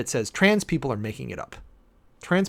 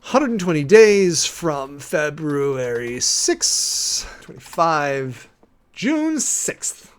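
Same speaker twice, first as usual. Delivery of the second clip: slow, drawn-out dictation of a date; dictating while typing; 2 semitones higher, 6 dB louder, much slower than usual. Real, not acted. To viewers while entering a date into her calendar, slow dictation. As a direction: stretch each word out as you type it into the calendar. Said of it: "I am putting on my calendar as we speak".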